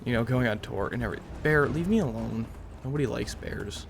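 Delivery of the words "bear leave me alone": usual speaking voice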